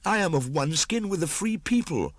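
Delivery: high, loud youthful protest; young man insisting he belongs with wolves. A narrator's reading. Mowgli pleads his wolf blood to the pack.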